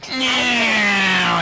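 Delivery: high meow; a high sung-out meow; monster meow. Pretend. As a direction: meow like a playful little monster. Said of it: "And now he goes".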